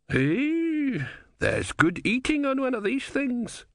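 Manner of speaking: put-on high, cackling old man; a greedy old codger spotting lunch; old philosopher voice. A narrator's reading. Didactylos voiced in narration.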